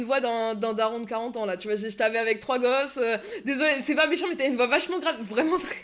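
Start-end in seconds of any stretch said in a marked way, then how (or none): 1.5-5.8 laughing. Real, not acted. Teasing a player's deep voice.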